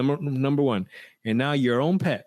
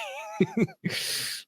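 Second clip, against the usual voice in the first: breathy laugh; 4 dB quieter than usual. Real, not acted. Laughing at son's fear.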